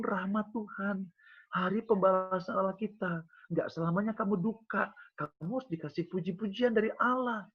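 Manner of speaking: preacher declaring deliverance to the congregation; preaching. Acted. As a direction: proclaim it like a sermon climax. Preaching at full force.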